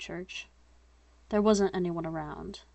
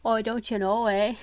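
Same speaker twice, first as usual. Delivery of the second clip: hammed-up Canadian lilt, a little louder; mock Canadian accent; 3 semitones higher, 3 dB louder than usual. Shown as a demonstration. Reader showing how the line sounds out loud, mock Canadian accent. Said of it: "a Canadian accent".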